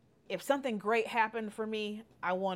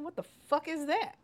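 baffled; 9 semitones higher than usual. Retold or imitated. Baffled reply to the girl.

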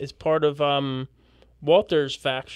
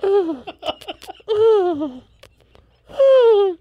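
high mock moans; 5 dB louder, much slower than usual. Mock romance moaning.

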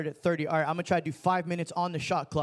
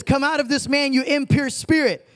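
higher, louder command; Jesus casting out a demon; commanding rebuke; 6 semitones higher, 5 dB louder than usual. Acted. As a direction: order the spirit out with authority.

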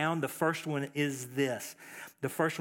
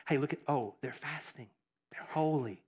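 softer, hushed mock admiration; mocking onlookers; 4 semitones lower, 6 dB quieter than usual. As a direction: imitate gawkers fussing over the holy fasters. Mocking onlookers praising fasters.

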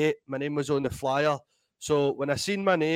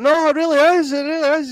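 much higher, louder voice, eager and insistent; promoter insisting; 12 semitones higher, 8 dB louder than usual. Retold or imitated. Promoter on the phone insisting to doubting Mick, high and eager. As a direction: play the promoter swearing it's really him. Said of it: "He's like".

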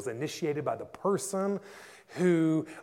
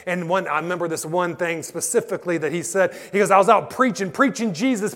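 loud, driving preacher's cadence; full-force preaching; 5 semitones higher, 11 dB louder than usual. Acted. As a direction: preach it out to the whole crowd.

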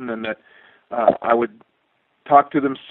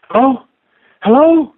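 much higher, louder, climbing panicked hellos; a wife straining to hear a dead line; frantic wife; 7 dB louder than usual. Retold or imitated. Wife on a bad phone line before launch, rising panicked hellos. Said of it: "I hear my wife's voice say".

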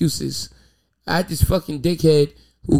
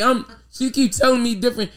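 normal talking voice

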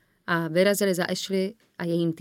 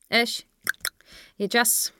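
higher, curt call for attention; 5 semitones higher, slower than usual. A narrator's reading. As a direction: cut in brusquely as a press officer. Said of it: "ozval se příkrým hlasem". (příkře, úsečně)

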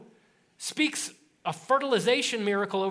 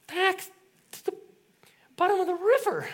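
higher, stammering panic; panicked; 10 semitones higher than usual. Retold or imitated. Prophet telling Elisha his axe sank, high and panicked. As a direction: blurt it out in a fluster.

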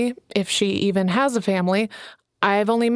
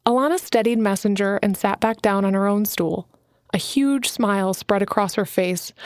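ordinary delivery, nothing marked

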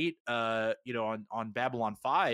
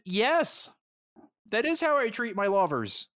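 mock defiant retort; 10 semitones higher, 4 dB louder than usual. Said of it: "then she said".

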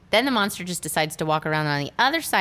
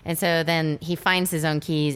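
normal talking voice

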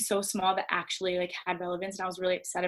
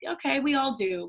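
higher, louder dry comeback; sarcastic retort; 6 semitones higher, 4 dB louder than usual. Retold or imitated. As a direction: toss it back with mock patience. Her sarcastic retort retold.

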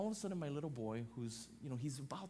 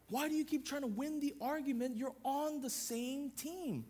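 raised, rhythmic preaching cadence; pitched-up pulpit delivery; 10 semitones higher than usual. Acted. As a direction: preach it, building line by line. Pressing the same-team point.

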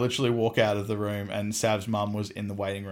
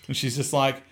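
ordinary talk

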